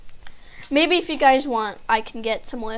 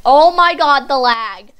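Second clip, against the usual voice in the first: loud, raised-pitch complaint; exasperated outcry; 13 dB louder than usual. Real, not acted. Player hit by sudden lag, exasperated outcry. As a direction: burst out at the glitch. Game starts lagging.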